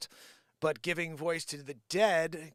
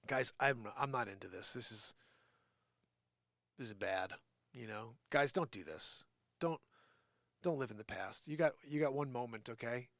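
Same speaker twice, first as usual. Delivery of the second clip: sincere plea; 6 semitones lower, 6 dB quieter than usual. Real, not acted. Earnest plea to viewers.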